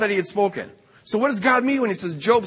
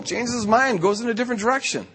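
ordinary talk

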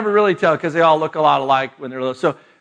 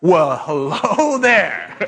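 slow, drawn-out greeting through laughter; very slow amused hello, laughing; much slower than usual. Retold or imitated. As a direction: greet the broken arm with a chuckle. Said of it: "I'm like".

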